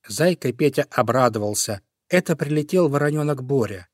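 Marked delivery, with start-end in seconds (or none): none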